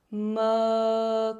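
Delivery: "mã" sung with a round open mouth. Shown as a demonstration. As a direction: shape an O around "mã". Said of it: "abertura da boca mais arredondada".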